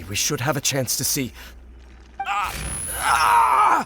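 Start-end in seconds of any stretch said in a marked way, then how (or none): 2.3-3.9 sharp high cry of pain. Acted. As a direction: cry out as if struck. Sudden pain from ley disturbance.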